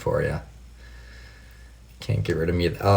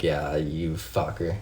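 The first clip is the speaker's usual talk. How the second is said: affectionate curse; 3 semitones lower, 7 dB quieter, slower than usual. Retold or imitated. Realizing mom pranked her.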